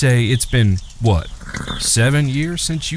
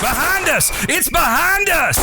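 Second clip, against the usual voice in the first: high terrified yell; terrified shout. Acted. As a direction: scream a warning in panic. Brothers panic at unseen monster.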